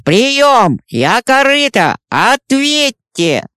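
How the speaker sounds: loud, high radio call; radio static. Acted. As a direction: shout into a crackling radio. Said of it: "Сквозь помехи в эфире".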